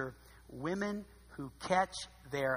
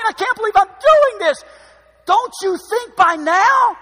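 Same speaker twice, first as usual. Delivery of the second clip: much higher, loud, fast ranting; Samson's exasperated outburst; 23 dB louder than usual. Retold or imitated. Samson fed up with Delilah, loud exasperated rant. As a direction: vent it like a fed-up husband. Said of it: "Let me tell you what I'm saying".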